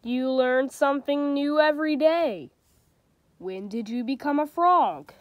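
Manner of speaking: storybook reading with light character voices; reading to children. A narrator's reading. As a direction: read it like a bedtime picture book. Reads a picture book aloud.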